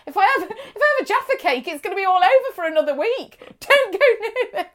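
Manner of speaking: high-pitched, hammed-up pleading; mock desperate protest. Dieter begs slimming friend off cake, mock desperate protest. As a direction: protest in mock panic, on the edge of laughter.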